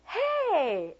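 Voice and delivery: high, drawn-out hey; over-bright greeting. Retold or imitated. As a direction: greet them as if you know them. Acting her awkward greeting back.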